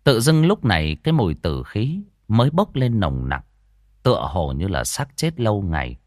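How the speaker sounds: lively village ghost-story telling; storyteller voice for a spooky folk tale; story narration. A narrator's reading. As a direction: draw the listener into the scene.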